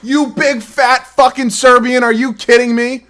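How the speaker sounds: high, loud, slow enraged rant; furious yell. Real, not acted. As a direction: rage at the screen, stretching each word. Furious at player stripped.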